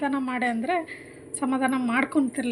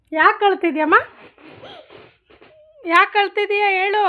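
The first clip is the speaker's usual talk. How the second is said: high, loud parental questioning; pitched up and loud over the crying; raised-voice questioning; 8 semitones higher, 8 dB louder than usual. Real, not acted. Mother questioning her crying child.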